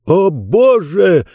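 high, drawn-out exclamation; shocked cry. A narrator's reading. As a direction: cry out slowly in shock, pitched well up. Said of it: "закричал Джонс".

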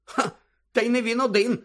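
high voice after a snorted ha; scoff, then grumble it high; derisive snort. A narrator's reading. Fudge complaining he is disappointed too, snorting. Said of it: "пирхнув Фадж".

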